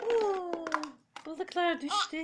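high, loud and laughing. Real, not acted. Fish toys fall, playing with baby.

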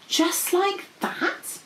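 high, slow, hushed wonder; awed. A narrator's reading. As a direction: ask it in wide-eyed amazement. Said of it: "Princess P said in awe".

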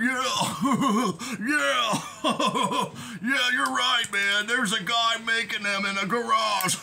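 giggly yes-man voice, laughing through agreement; mock him with fawning, laughing agreement; mocking impression. Mocking the jazz master agreeing with Wax, laughing sycophantic voice. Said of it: "that's how he talks".

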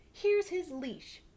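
put-on high, loud, slow triumphant girl; proud girl. Girl giving her ribbon as a leash, triumphant. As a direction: hand it over in triumph. She said.

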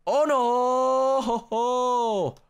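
high, drawn-out wail of dismay. Real, not acted. Player falling from the parkour, dismayed wail.

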